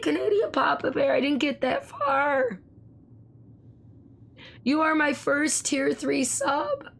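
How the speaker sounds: high-pitched, stunned and thrilled; excited disbelief. Real, not acted. Streamer reacting to a first tier three sub, overwhelmed with excitement. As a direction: gasp it out high, drawing out the ends. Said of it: "I'm just getting excited".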